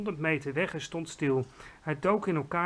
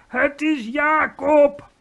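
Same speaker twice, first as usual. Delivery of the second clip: high, loud, drawn-out bellow; 10 semitones higher, 10 dB louder, much slower than usual. A narrator's reading. Giant names his dreaded enemy, high slow bellow. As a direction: bellow it slowly in terror. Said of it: "bulderde de vleeslapeter".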